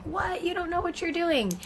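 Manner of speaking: high, sneering disbelief; mock judgmental mother; judgy mom. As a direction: look down on the other parent. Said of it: "moms are looking at you like".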